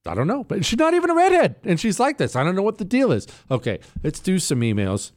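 normal talking voice

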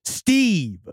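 odd, drawn-out name drop; weird intense stranger. Acted. Odd candidate glaring at the clerk, stilted intensity. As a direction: say your own name with eerie weight. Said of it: "felt like he was glaring into my soul".

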